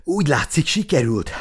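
gulping air between words; out of breath. A narrator's reading. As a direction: speak through heavy panting after a run. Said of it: "kapkodta a levegőt".

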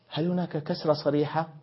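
ordinary talk

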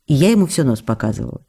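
normal talking voice

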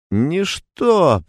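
put-on slurred drunken mumble; barely moving the tongue, drunk; blackout drunk. A narrator's reading. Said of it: "пробормотал Штударь, еле ворочая языком".

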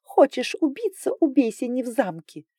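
high, sneering mock of a threat; mocking the ruler. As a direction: parrot the ruler's warning mockingly. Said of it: "передразнивая правителя".

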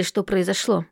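plain reading voice

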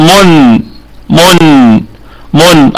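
من with the lips rounded on the first ميم, then spread on the second with a held nasal hum. Shown as a demonstration. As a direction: slowly show من, closing the lips and then opening them. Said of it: "نضم في الميم الثانية". (نطق من بضم الشفتين ثم بسطهما مع غنة)

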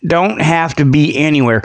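usual speaking voice

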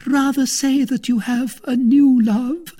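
very high, loud female character voice; jealous wife. Acted. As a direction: reproach a faithless lover. Wife's jealous reproach.

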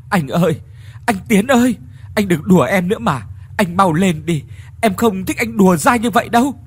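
loud, high, tearful pleading; anguished crying out. Acted. Ngọc begging her drowned husband Tiến, anguished high cry. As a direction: beg him to stop joking, voice breaking. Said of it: "Ngọc đau đớn thốt lên".

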